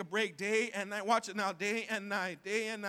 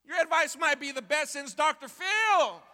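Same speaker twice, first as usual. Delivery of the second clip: ordinary delivery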